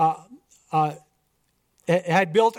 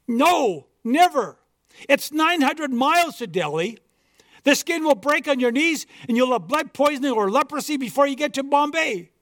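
alarmed missionary; 7 semitones higher than usual. Acted.

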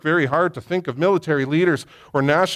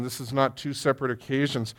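ordinary talk, nothing marked

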